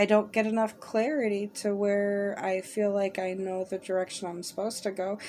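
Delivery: usual speaking voice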